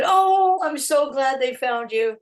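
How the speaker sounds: high, loud gushing "oh", acted out; delighted greeting. Star greeting her photographer in the hotel room, delighted. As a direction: gush with relief as in a retelling.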